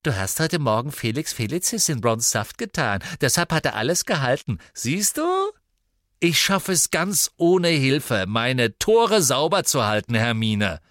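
ape her voice with spite; mimic. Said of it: "äffte ihre Stimme nach".

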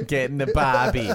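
mock East Coast tough talk; East Coast accent. Friend riffing with the group, mock East Coast accent. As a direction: order it in hammy East Coast. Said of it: "I'm from the fucking East".

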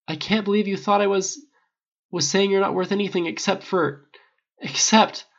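choking on the words, upset; flustered, stammering. Acted. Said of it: "so flustered and stammering".

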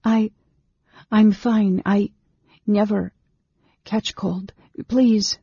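put-on high, halting girl's voice; flustered stammer. Girl flushing under the mage's smile, flustered stammer. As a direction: stammer excuses, breaking between words.

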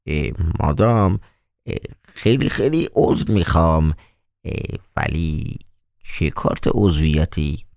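puzzled, mock-polite apology; mild surprise. Koroviev feigns surprise at the doorwoman, softly. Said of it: "با تعجب پرسید".

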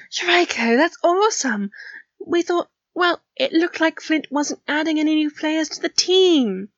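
high, happy reassurance; delighted congratulations. A narrator's reading. Rigel congratulates Draco after confusion.

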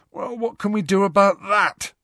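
a yawn through the words. A narrator's reading. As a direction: say it mid-yawn. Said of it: "said Ron, yawning".

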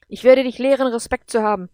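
enraged, commanding roar; furious threat. Acted. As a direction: thunder a threat of punishment. Enraged being punishes her.

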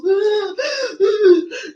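high, hammed-up crying gasp; imitating a crying ex gasping; mock sobbing gasp. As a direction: fake a big teary gasp. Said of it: "somebody just really crying".

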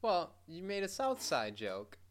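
usual speaking voice

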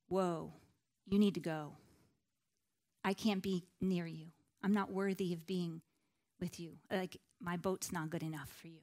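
low, soft, self-effacing plea; understated awe. Acted. Simon Peter telling Jesus to go, hushed and humbled. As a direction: gently beg off as unworthy. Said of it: "was one of awe".

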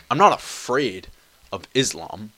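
ordinary talk

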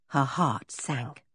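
ordinary delivery, nothing marked

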